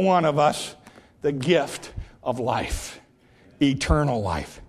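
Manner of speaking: preacher softly offers eternal life to the listeners; hushed. Acted. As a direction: let it fall to a reverent hush. Preacher drops to hush.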